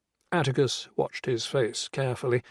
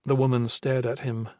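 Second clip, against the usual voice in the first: usual speaking voice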